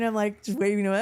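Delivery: ordinary delivery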